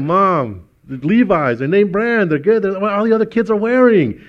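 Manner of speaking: put-on high, loud pleading kid; whining teen. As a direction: beg like a kid who wants name brands. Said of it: "I was like".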